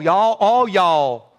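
slow, stretched Southern drawl. Shown as a demonstration. Pastor demonstrating the drawl to the congregation, slow Southern drawl. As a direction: draw out each y'all, Southern style. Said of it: "say it with that kind of drawl".

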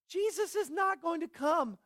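high, mocking voice of a scoffer; mocker. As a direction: pitch it way up, scoffing like a doubter. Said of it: "lots of mockers who say".

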